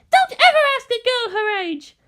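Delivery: put-on very high, loud prim girl voice; indignant little girl. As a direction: scold like an offended young lady.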